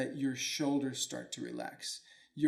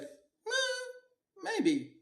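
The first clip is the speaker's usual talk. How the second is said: put-on very high squeaky old man; mock wise old Chinese man; shrugging old man. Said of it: "he's like".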